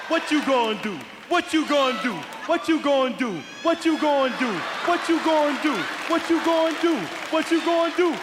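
rising pitch, taunting bully; building taunt. Retold or imitated.

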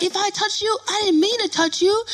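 high, pleading mock excuse; guy making excuses. Acts the guy's excuse.

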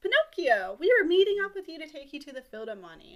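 high, loud mock-friendly greeting; hammed-up con man's welcome; hailing someone. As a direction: call out with overdone delight. Con men greet Pinocchio.

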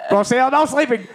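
mock doctor's loud scolding; imitated yelling. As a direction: yell to keep the patient awake. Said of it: "then he starts yelling at me".

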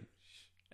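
soft drawn hushing sound; quiet retold "shh"; imitated shush. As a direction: shush like the person in the story. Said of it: "he was like".

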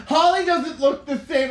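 high loud fretting voice; worried girl voice. Retold or imitated. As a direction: fret like an anxious fan. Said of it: "They're like".

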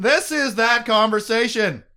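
loud, high, drawn-out announcement; slow, ringing exclamation; dramatic reveal. Acted. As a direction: proclaim it like a big reveal.

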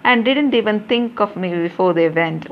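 usual speaking voice

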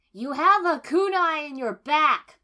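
loud, slow bark. Acted. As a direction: bark it at her. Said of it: "Kiba barked".

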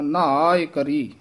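slow ਨਾਏ ਕਰੀ; ਨਾਏ drawn out; careful ਨਾਏ. Shown as a demonstration. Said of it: "ਇਹ, ਹਾਂ".

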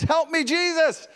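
high-pitched cry for help; mock desperate plea.

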